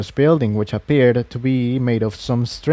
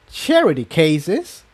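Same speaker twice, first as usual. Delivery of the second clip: indignant echo, slow and higher; huffy; 5 semitones higher, slower than usual. Acted. Refugee mare offended by the old pony, mild huff. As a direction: bristle at the insult. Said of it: "Incendia huffed".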